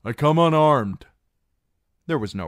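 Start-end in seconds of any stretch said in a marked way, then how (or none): 0.0-1.1 loud, high call to be heard; shouted plea. Acted. Reek calls to the unseen garrison, loud. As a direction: shout it up to the towers.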